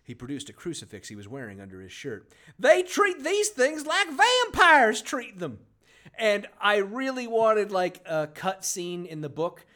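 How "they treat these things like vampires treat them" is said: high, loud, slow put-on voice; over-the-top quoted line, much higher and louder; hammed character voice. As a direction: stretch it out like a melodramatic informant.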